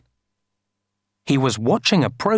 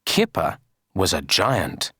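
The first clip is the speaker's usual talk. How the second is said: usual speaking voice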